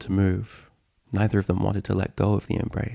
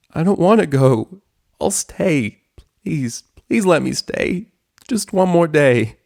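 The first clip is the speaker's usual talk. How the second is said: high, loud, drawn-out begging; desperate pleading; 9 semitones higher, 7 dB louder, slower than usual. Acted. Dragon begging his best friend to let him stay, desperate pleading. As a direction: plead desperately for a little more time.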